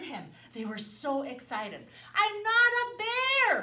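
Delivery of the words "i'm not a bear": slow, high, loud with NOT stressed; a boy firmly protesting; I'm NOT a bear, drawn out; insisting. Acted. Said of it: "Herman said".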